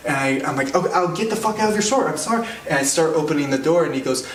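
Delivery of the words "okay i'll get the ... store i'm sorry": high, rushed placating; mock panicked apology. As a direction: hurriedly give in, pitched up. Frightened customer backing out, apologising.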